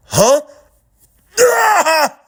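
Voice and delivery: sharp high yelp of shock; shocked cry. Acted. Mahito stabbed, crying out in shock. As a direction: jolt upward in surprise. Frank stabs himself and Mahito.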